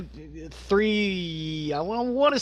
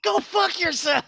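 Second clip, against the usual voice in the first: mock retort; 12 semitones higher, 4 dB louder than usual. Reenacting the waiter's retort.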